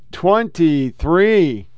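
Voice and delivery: twenty-three stretched out very slowly; drawn-out date. Real, not acted. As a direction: drag out twenty-three for effect.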